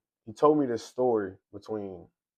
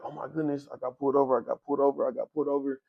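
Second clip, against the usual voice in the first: imitating a driver's higher, repeated panic; mock panic; 4 semitones higher, faster than usual. Speaker imitates a driver who just got pulled over, mock panic. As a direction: play a half-joking flustered driver.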